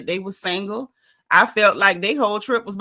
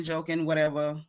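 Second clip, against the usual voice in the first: ordinary talk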